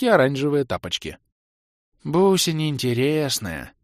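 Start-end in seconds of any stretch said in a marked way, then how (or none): 2.0-3.7 slow, sighing, lightly voiced bald elf. A narrator's reading. Bald elf sighs teasingly about the baroness. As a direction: let it out on a lazy sigh. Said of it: "вздохнул лысый эльф".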